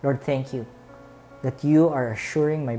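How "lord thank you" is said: ordinary talk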